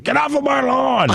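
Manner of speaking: put-on high, shouted old-man yell; cranky old man. Cranky old man yelling at kids off his lawn. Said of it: "cranky old man Jenkins over here".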